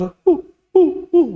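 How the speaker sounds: high-pitched imitated sobs; mock sobbing. Teacher imitating overdone weeping in prayer, mock high sobs. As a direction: fake short, high crying hoots. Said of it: "nggak boleh misalkan nangis".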